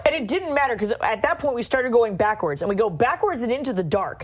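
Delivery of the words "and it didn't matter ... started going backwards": ordinary talk, nothing marked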